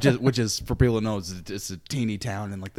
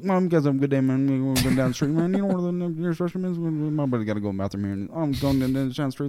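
put-on rural Iowa accent; 5 semitones higher, 6 dB louder than usual. Missionary talking to the householder in the rural Iowa accent he picked up. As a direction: lay the rural Iowa twang on thick. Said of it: "I totally developed the accent".

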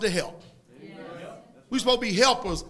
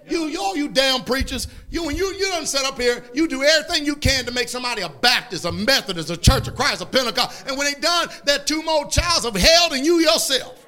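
fiery preaching, building higher and louder; preaching rebuke; 4 semitones higher, 3 dB louder than usual. Acted. Preacher confronting preachers and their converts, scathing rebuke. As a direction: lay into them, rising to a loud finish.

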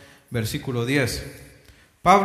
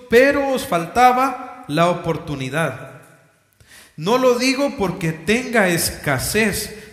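louder, declaiming Bible reading; solemn public reading of scripture, raised voice; 3 semitones higher, 5 dB louder than usual. Acted. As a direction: proclaim the apostle's words to the church. Reads Philippians aloud to congregation.

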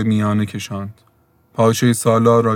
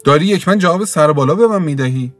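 higher, angry scolding of a poor widow; scolding rage; 6 semitones higher than usual. Acted. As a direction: tear into her as the angry creditor. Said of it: "به باد تشر گرفت".

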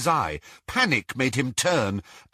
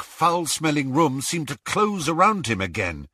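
usual speaking voice

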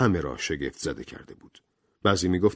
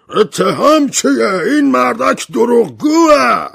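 much higher, louder, slow outraged shout; 11 dB louder, slower than usual. Acted. General MacArthur denying the charge, slow furious shout. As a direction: bellow it in outrage. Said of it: "فریاد زد".

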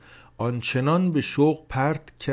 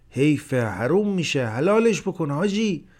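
جیغ زن؛ صدای زیر و بلند زن؛ ملتمس جیغ بکش؛ جیغ زن به حاجی برای حلال کردن; 6 semitones higher, 6 dB louder than usual. A narrator's reading. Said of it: "زن دوباره جیغ زد".

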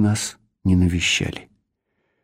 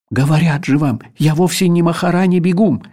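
suddenly frightened; 6 semitones higher, 4 dB louder than usual. Acted. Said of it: "неожиданно испугавшись".

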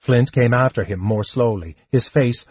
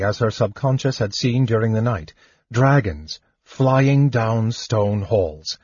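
usual speaking voice

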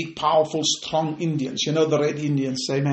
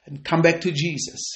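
ordinary delivery

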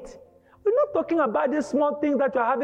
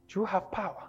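quiet question; 3 semitones lower, 9 dB quieter, faster than usual. Acted.

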